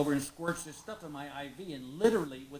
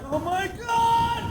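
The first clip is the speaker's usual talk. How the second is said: high, slow, loud shriek of alarm; slow, high, shouted panic; mock horror shout; 12 dB louder, much slower than usual. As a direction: yell it at the coiled cord. Seeing the long scope cord.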